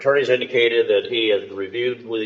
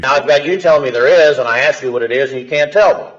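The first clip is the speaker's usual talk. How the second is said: louder and higher, fed up; exasperated scolding; 5 semitones higher, 7 dB louder than usual. Real, not acted. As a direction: lay into him, exasperated. Judge loses patience with defendant.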